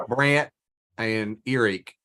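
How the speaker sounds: Brant with a flat Southern vowel; Southern accent. Shown as a demonstration. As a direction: drawl Brent into Brant, West Virginia style. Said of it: "Can you do a little Southern accent".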